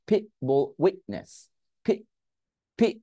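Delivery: "pit" and "witness" with unreleased Ts; stopped T. Shown as a demonstration. Teacher isolating the silent T for students, stopped T. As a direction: cut "pit" off at the T. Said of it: "টি উচ্চারণ করে নেই".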